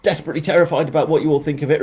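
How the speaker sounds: ordinary delivery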